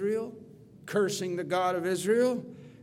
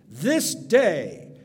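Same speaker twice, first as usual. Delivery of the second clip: bold declaration; 5 semitones higher, 6 dB louder than usual. Acted. Preacher voicing David defying Goliath, loud and bold. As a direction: declare it with unshakable faith.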